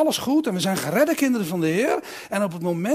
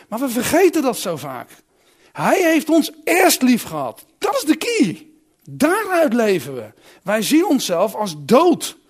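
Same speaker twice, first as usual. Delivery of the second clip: full-force preaching; 3 semitones higher, 4 dB louder than usual. Acted. Preacher to congregation about God's love, fervent full-voiced preaching. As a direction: lift the congregation with the love of God, loudly.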